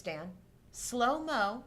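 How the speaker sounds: ordinary talk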